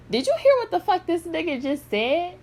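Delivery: high-pitched mock disbelief; incredulous retelling. Vlogger quoting a man's video reaction, high incredulous voice. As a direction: act out someone stunned by what they heard.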